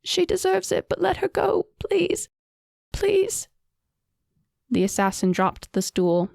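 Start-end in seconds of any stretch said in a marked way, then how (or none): 0.0-3.5 much higher, tearful begging; crying plea. A narrator's reading. Sobbing sister begging Amira to let the queen go, crying. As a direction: plead through tears. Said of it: "Fonra was crying".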